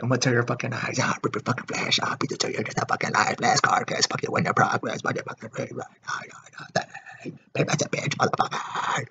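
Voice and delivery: harsh breathy rasp, mostly soft and voiceless; whispered growl of the lyrics. Retold or imitated. Fan imitating Orion's vocals, softly rasped and voiceless. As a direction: rasp the death-metal lyrics half under your breath like a mock growl. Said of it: "just hearing [name], like".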